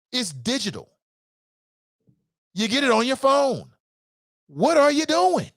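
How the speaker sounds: high-pitched incredulous exasperation; exasperated. Real, not acted. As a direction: exclaim it high, fed up with them. Exasperated at uninformed candidates.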